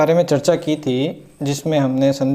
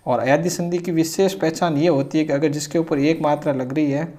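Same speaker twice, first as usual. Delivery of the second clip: ordinary talk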